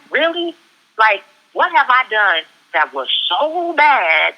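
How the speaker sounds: high, drawn-out protest; exasperated plea. Retold or imitated. Retelling moments questioning the Creator, exasperated pleading. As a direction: plead upward, stretching the words slowly. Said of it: "moments with the Creator where I'm like".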